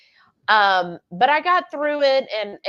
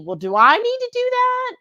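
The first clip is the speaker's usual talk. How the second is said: much higher, faster anxious question; confused newcomer to keto wondering about carnivore, much higher mock panic; mock confusion; 14 semitones higher, 5 dB louder than usual.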